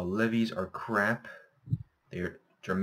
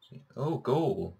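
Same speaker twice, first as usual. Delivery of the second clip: excited; 5 semitones higher, 4 dB louder, slower than usual. Real, not acted. Finds gold in game.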